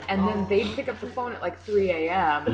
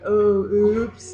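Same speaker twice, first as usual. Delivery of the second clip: louder put-on nephew voice; nephew's oops; 3 semitones higher, 5 dB louder than usual. Nephew woken by a thrown shoe, imitated oops. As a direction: imitate someone caught out on waking. Nephew waking, sheepish, in her retelling.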